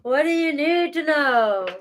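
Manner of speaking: sing-song tease to the chat; silly put-on voice. Repeats in silly voice.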